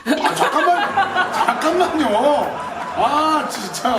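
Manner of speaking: high, slow words through laughter; can barely speak for laughing. Real, not acted. Laughing fit while eating.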